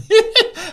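high, loud laugh; burst of laughter. Real, not acted. Storyteller recalling the eggs to co-traveller, sudden high laugh.

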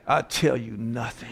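low, slow, hushed stonewalling; defiant prisoner. Acted. Husband answers wife's "How's your day?", low slow prisoner defiance. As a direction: answer like an interrogated captive.

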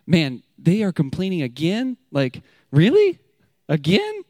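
exasperated disbelief. Retold or imitated. Preacher voicing his own exasperation at the complaining Israelites, incredulous. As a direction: roll your eyes in disbelief. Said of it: "Sometimes I think to myself".

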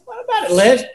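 high, bright call of recognition; surprised greeting. Retold or imitated. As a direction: call out to an old friend in surprise. Greeting old classmate at store.

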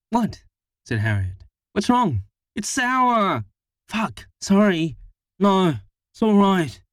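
high, flustered voices; panicked. A narrator's reading. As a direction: scramble to apologise. Panicked exchange over sour milk.

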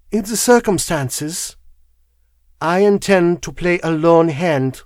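put-on mildly resolute, foreign-accented declaration; foreign wife. A narrator's reading. Foreign wife declares her plan.

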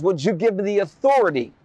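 normal talking voice

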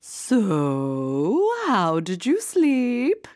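high, slow sing-song queen; drawn-out eager queen question. A narrator's reading. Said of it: "asked the queen".

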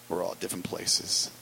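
ordinary delivery

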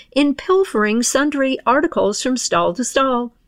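ordinary delivery, nothing marked

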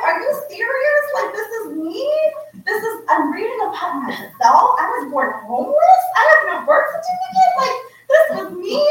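retelling the shock of reading her own past; stunned disbelief. Retold or imitated. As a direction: ask as if the ground just vanished. Reading shocking articles about herself.

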